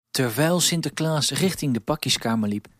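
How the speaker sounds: usual speaking voice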